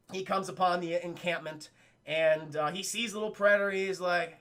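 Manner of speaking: ordinary talk, nothing marked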